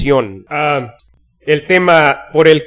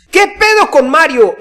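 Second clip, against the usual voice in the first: exasperated quote; 11 semitones higher, 6 dB louder than usual. Retold or imitated. Reads an irritated listener's comment.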